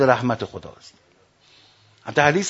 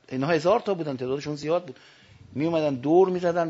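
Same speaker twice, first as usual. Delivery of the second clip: ordinary talk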